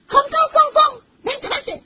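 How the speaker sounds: high, loud welcoming call; eager calling in. Acted. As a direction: wave them inside eagerly. Inviting guest in, calling out.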